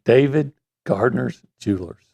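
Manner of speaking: slow, unhurried sponsor read. Shown as a demonstration. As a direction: take your time over the sponsor name. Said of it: "you can slow it down on this one".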